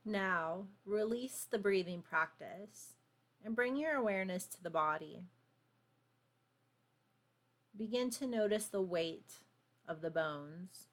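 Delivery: calm, unhurried meditation voice; guided meditation. Acted. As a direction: guide them gently into stillness. Leads a grounding meditation.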